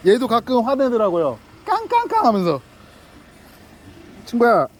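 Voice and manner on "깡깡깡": mock dog yapping. Mimicking the dog's angry yaps.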